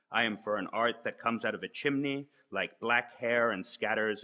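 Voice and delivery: emphatic, measured poem-like reading; incantatory list, each "I am for" launched anew; manifesto recitation. Acted. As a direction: declaim it like an invocation, pausing between phrases. Reads manifesto aloud as invocation.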